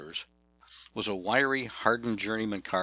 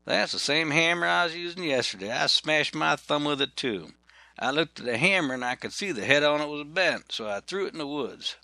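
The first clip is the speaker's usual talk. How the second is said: Ken's voice; 6 semitones higher than usual. Retold or imitated. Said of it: "Ken said".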